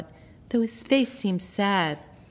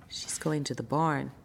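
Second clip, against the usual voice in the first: hushed aside; 6 semitones lower, 8 dB quieter than usual. A narrator's reading.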